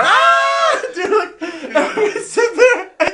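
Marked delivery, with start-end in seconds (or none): 0.0-0.8 very high, loud mock shriek; imitated frightened scream. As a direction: shriek at the wave, half laughing. Imitating fright as water returns.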